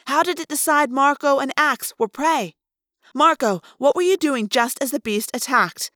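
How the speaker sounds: high, loud, racing to explain; words tumbling out in discovery; excited realization. A narrator's reading. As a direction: Cassie laying out her theory. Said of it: "Cassie is not a person who yells".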